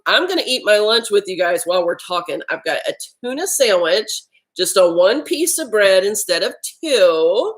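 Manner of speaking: ordinary talk, nothing marked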